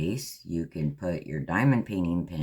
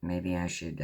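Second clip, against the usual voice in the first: ordinary delivery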